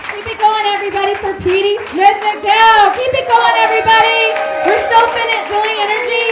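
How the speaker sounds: loud hype for the crowd; hype host. Real, not acted. Host hyping the crowd for the comic, loud and excited. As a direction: pump up the applause, loud.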